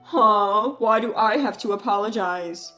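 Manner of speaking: loud scoffing huh, then dismissive; scornful brother. Acted. Brother refuses to apologize to Mark, scornful. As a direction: brush off the apology with contempt.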